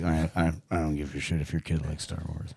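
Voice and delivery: understated, low-pitched grump; Harrison Ford. Acted. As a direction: play it low and indifferent. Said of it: "like, subdued Harrison Ford".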